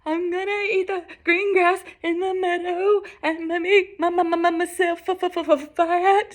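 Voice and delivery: high-pitched, stammering goat voice; frightened goat. Acted. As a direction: stutter through it in a high, scared voice. Terrified goat answers the troll.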